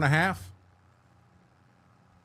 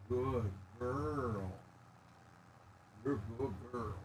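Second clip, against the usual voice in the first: praising dog; 3 semitones lower, 18 dB quieter than usual. Real, not acted.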